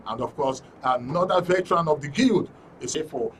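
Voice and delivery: ordinary talk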